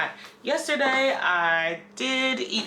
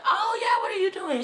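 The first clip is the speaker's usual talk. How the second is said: surprised greeting; 13 semitones higher, faster than usual. Retold or imitated. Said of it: "I was like".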